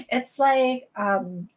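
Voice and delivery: gossipy put-on Valley Girl accent. Shown as a demonstration. Showing viewers Valley Girl, gossiping about Gucci and Prada, Valley Girl accent. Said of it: "What's Valley Girl?".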